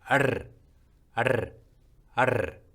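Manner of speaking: 아르 with a short, strong trilled r; 아르 said with a quick forceful rolled r. Shown as a demonstration. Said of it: "짧고 강하게 혀를 떨어줍니다".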